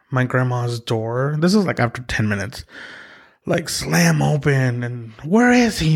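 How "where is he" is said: high, loud demanding shout; angry dad. Retold or imitated. Father storming into grandma's house, shouting. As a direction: burst in yelling for the boy.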